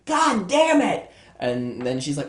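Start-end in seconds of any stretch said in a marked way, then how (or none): 0.1-1.1 high-pitched, loud acted scream; yelling in the window. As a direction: yell a desperate warning into the house. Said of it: "yelling in the window".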